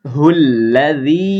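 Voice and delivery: উজুহুল্লাযী with the ll held double, slow; উজুহুল্লাযী recited slowly with a doubled l. Shown as a demonstration. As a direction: lean on the ll of উজুহুল্লাযী. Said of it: "তাসদিদের কাজ করতে হবে".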